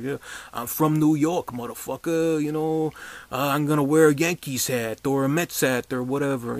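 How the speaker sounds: put-on tough-guy New York cop in a light New York accent; mock New York accent. Reviewer mocks the actor's New York cop accent, in a light New York accent. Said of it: "he's doing the New York accent".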